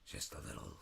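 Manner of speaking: low, gravelly menace in halting pieces; hushed intimidating rasp with long pauses; Christopher Walken. Shown as a demonstration. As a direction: get down low and gravelly, as if trying to scare. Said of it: "when it gets low, gravelly".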